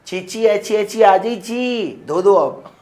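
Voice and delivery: put-on high, loud childish lisping call to mummy. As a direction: call out like a little kid who needs help. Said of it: "तुमने बोला".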